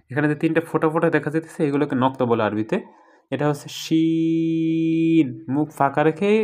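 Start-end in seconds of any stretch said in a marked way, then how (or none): 3.3-5.3 শিন stretched long and slow; drawn-out Sheen. Shown as a demonstration. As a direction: hold শিন long, mouth open. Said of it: "টান দিয়ে বলতে হবে শিন".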